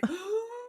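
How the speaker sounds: high, soft, drawn-in fangirl gasp; long high intake of breath; excited gasp. Retold or imitated. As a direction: gasp in mock-starstruck delight. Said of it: "And I'm like".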